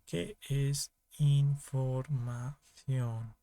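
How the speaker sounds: very slow, drawn out syllable by syllable; slow, deliberate question. Real, not acted. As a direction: stretch each word out for the class. Profesor recalca la pregunta clave. (pregunta lenta, alargando cada sílaba)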